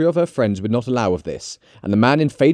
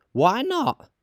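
very high, challenging question; high-pitched brazen woman; unashamed charwoman; 12 semitones higher than usual. Acted. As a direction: toss back a high retort. Charwoman challenges Joe's shock.

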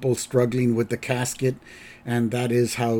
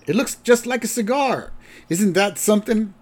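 higher, lightly voiced sleazy lawyer; salesman lawyer; 9 semitones higher than usual. Lawyer pushes his cigar pen, higher mock sales voice. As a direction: pitch it up like a cheesy sales line. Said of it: "He says".

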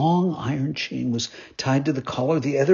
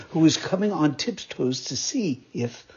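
ordinary delivery, nothing marked

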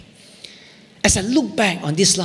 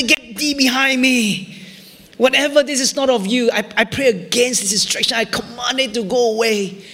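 raised, urgent pleading prayer against the distraction; fervent prayer; 6 semitones higher than usual. Retold or imitated. Pastor retelling his prayer against the job offer, rising and forceful. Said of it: "I said".

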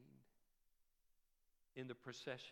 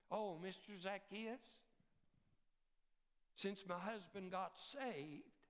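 ordinary talk, nothing marked

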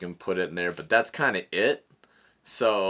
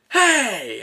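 high, loud startled yell; mock angry shout; sharp shout; 12 semitones higher, 7 dB louder than usual. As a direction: catch a thief red-handed. Dancer catches him stealing.

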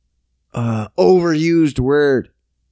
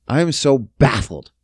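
ordinary delivery

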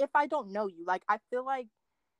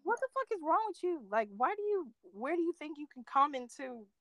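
high, incredulous telling-off; indignant; 7 semitones higher than usual. Retold or imitated. Woman retelling how she'd tell off a pushy date, indignant. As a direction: challenge them in a raised, affronted pitch.

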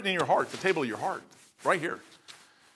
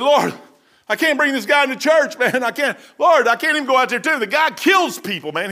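higher, louder desperate plea to God; fearful pleading; 5 semitones higher, 4 dB louder than usual. Retold or imitated. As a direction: beg the Lord, scared. Ananias pleading with the Lord.

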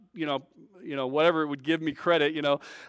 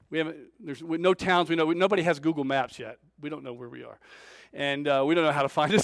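usual speaking voice

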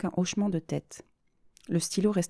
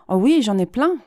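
louder, higher eager burst; exclaiming; 5 semitones higher, 8 dB louder than usual. Acted. Patient eager to prove her point, exclaiming loudly. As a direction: blurt it out, almost pleased. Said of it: "s'exclama Océane".

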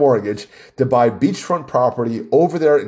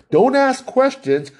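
mocking, pitched much higher and slowed; mock order; 11 semitones higher, slower than usual. Mocking the Democrats' orders to listeners, high and sneering.

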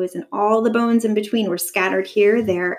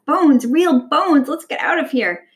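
plain reading voice

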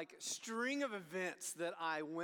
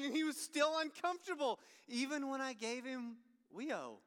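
usual speaking voice

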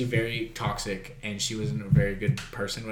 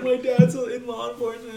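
much higher, whiny plea; pleading drunk; 12 semitones higher, 5 dB louder than usual. Retold or imitated. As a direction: beg the cops in a high whine.

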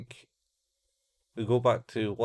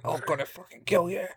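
high-pitched strained scream, held back in volume; imitated screaming at reduced volume; mock scream; 9 semitones higher than usual. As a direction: scream threats in a small, high voice. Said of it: "As he's screaming, like".

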